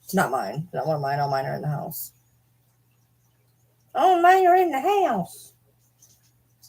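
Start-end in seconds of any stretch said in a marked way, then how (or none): 3.9-5.5 very high, slow cartoonish voice; silly high voice. Real, not acted. Vlogger repeats herself to the camera in a squeaky silly voice.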